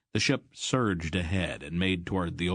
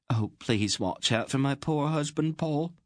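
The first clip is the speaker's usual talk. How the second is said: higher, a touch of a tearful laugh; quivering little laugh; 8 semitones higher than usual. A narrator's reading. Queen asking Aunt Paul to protect her husband, lightly tearful. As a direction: brave face with a slight quiver. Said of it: "with a little laugh that quivered a bit".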